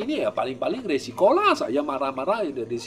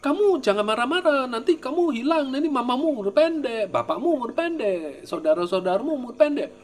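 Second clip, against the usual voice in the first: warning quote; 10 semitones higher than usual. Retold or imitated. Voicing someone warning the practitioner.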